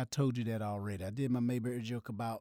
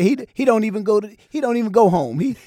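louder, higher punchline; joking shout; 5 semitones higher, 12 dB louder than usual. Retold or imitated. Telling the crowd about Otis, louder and higher. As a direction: belt out the punchline. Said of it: "I said".

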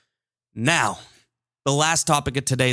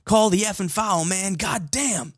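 higher-pitched mock yell at a referee; imitated yell; 7 semitones higher, slower than usual. Host imitates player protesting to the ref, aggressive yell. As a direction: act out an aggressive protest without full volume. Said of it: "got up and yelled at the ref".

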